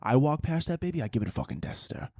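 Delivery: ordinary delivery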